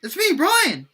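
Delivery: high, loud greeting; excited yell. A narrator's reading. Brian reveals himself to William, yelling in excitement. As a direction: call out eagerly to an old friend. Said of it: "he yelled in excitement".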